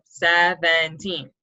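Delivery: "Seventeen" slow, with three separate beats; broken into syllables. Shown as a demonstration. Teacher showing three breaks in that word, "Seventeen" slowly segmented. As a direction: say SE, VEN, TEEN for "Seventeen". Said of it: "three different breaks ups in that word".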